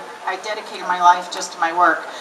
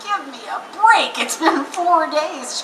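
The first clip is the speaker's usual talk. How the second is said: higher, slow give-me-a-break; mock exasperated; 6 semitones higher, slower than usual. Tired daughter brushes off her mom, mock exasperated. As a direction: drag it out like a tired protest. Answering mom after long drive.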